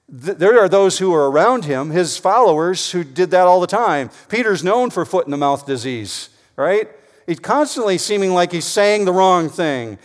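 loud pulpit emphasis; preaching at full force. Acted. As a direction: drive the point home to the room.

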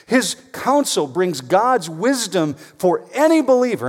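believer hit loud and high; preached line peaking on believer; stress on believer. Shown as a demonstration. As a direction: land hard on believer. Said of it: "and I emphasize believer".

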